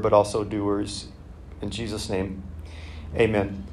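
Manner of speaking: soft, low spoken prayer. Real, not acted. Pastor praying over the reading, soft and low. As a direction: pray quietly and humbly. Pastor prays before the sermon.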